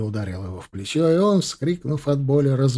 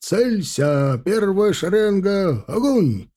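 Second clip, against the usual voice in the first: high, slow shouted orders; combat callout; 6 semitones higher, 3 dB louder, much slower than usual. Acted. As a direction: yell fire commands down the line. Officer commanding volley fire.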